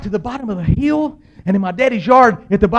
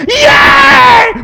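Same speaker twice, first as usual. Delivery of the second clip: very high, full-volume shriek of joy; excited cheer; 13 dB louder than usual. Retold or imitated. Boy celebrates riding his bicycle, wildly excited. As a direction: let out a huge thrilled yell. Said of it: "I was so excited".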